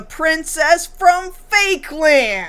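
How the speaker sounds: high, slow singsong announcement; drawn-out mock-royal title; mock grand reveal. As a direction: proclaim it slowly like a fanfare.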